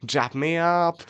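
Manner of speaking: hammed-up posh chap; exaggerated upper-class British accent; posh English accent. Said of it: "actual posh people".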